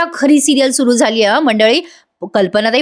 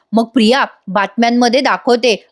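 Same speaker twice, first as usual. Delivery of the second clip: ordinary talk, nothing marked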